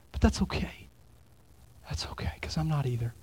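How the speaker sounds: soft, consoling whisper; gentle whisper. Acted. Pastor answers a self-righteous friend in a gentle whisper. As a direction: hush it like sharing a confession.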